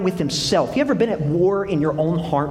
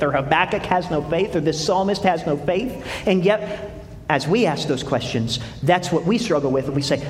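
ordinary talk, nothing marked

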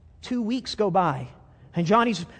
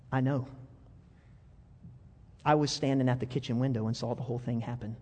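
tender mother; 6 semitones lower, 6 dB quieter than usual. Acted. Mother tenderly tells son.

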